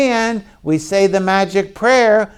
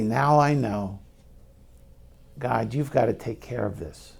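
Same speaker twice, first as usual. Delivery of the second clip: quiet surrender; 6 semitones lower, 7 dB quieter, faster than usual. Acted. Acts out a prayer of surrender.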